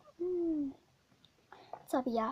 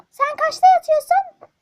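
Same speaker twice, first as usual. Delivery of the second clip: furious mother; 12 semitones higher, 15 dB louder than usual. Acted. Mother scolds son.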